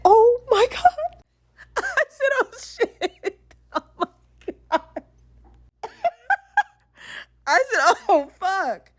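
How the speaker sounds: very high squeal dissolving into laughter; mortified laughter. Retold or imitated. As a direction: retell the shock, barely getting words out for laughing. Laughing, mortified by friend's blunt answer.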